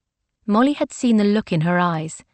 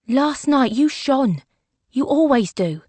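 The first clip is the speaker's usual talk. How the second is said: sincere; 5 semitones higher, slower than usual. A narrator's reading. Earnest compliment, slowed.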